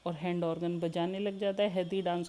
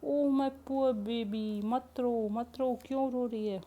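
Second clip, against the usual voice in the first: soothing grandmother; 6 semitones higher, 3 dB louder, slower than usual. Acted. Grandmother comforts crying girl.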